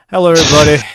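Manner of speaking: shouted hello to the crowd; loud greeting. Real, not acted. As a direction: belt it out like a host. Redoing the intro louder.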